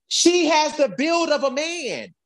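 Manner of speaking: high-pitched, incredulous rant; exasperated outburst. Real, not acted. Exasperated rant about the Red Ranger.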